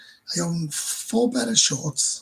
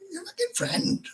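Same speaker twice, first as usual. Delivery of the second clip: much higher, mocking disbelief; incredulous retort; 4 dB quieter than usual. Mocking the girl's claim about her friend, pitched up in disbelief. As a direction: throw it back at her in disbelief.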